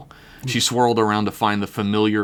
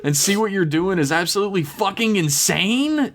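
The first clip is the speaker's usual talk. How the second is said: yelling; 10 semitones higher than usual. Acted. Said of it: "T K screamed out".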